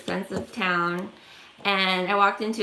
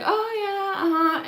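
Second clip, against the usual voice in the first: high, fake-interested murmurs; polite uh-huh; 10 semitones higher than usual. Woman humouring the stranger, mock polite uh-huh. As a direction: humour him with polite noises. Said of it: "and I'm like".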